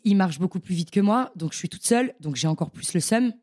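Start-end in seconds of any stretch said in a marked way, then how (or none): none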